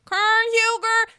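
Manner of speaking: high, loud cheer of her name; excited high call. Real, not acted. Vlogger cheering for Karen in a high, loud call. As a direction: hail her like a star.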